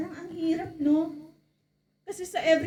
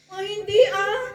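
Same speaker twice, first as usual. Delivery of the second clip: higher, louder startled denial; 7 semitones higher, 7 dB louder than usual. Acted. Peter denying Jesus when a woman recognizes him. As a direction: deny it fast, caught off guard.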